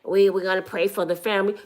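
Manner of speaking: quick dismissive imitation of the president; mock Trump quick aside. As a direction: toss it off fast and quiet. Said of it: "you threw in there real quick".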